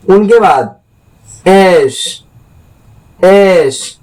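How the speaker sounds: एस drawn out slowly and clearly; एस carefully modeled. Shown as a demonstration. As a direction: stretch out एस for the class. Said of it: "जिस तरह से".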